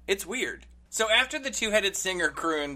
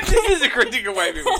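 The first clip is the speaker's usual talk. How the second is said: laughing repeat; 8 semitones higher, 10 dB louder, faster than usual. Retold or imitated. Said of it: "I was listening to".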